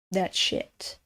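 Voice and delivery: muttered under the breath in disgust; a low, soft disgusted aside. Real, not acted. Viewer hears her mutter about mushrooms in disgust.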